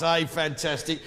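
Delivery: usual speaking voice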